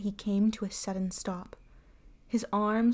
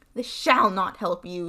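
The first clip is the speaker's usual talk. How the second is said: cold anger; 6 dB louder than usual. A narrator's reading. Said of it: "said Bluebeard".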